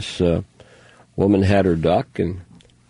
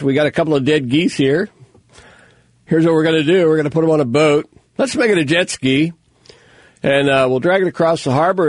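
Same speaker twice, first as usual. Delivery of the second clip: higher-pitched, hammed-up announcer pitch; rally a crowd for a silly contest; mock event promoter; 6 semitones higher than usual. Host inviting everyone to a dead goose contest, mock hype.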